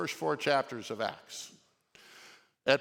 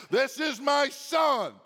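"This is my son" shouted high and slow; booming voice from heaven; 9 semitones higher, 6 dB louder, much slower than usual. Shown as a demonstration. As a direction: proclaim "This is my son" like God from heaven. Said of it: "that's how the voice from heaven sounds".

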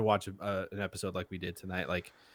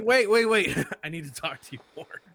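much higher, louder, desperate "wait, wait, wait"; frantic pleading; 9 semitones higher, 7 dB louder than usual. Retold or imitated. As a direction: chase after him in a high panic. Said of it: "It's like".

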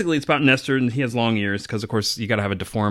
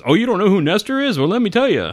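folksy narrator; 8 semitones higher than usual. Retold or imitated. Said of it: "And he's like".

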